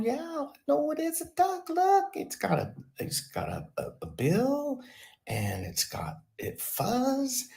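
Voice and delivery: put-on very high childish voice, insisting; whiny kid voice. Jamie at thirty insisting to parents it's a duck, high childish whine. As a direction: squeal it like a stubborn little boy.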